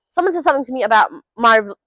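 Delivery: ordinary talk, nothing marked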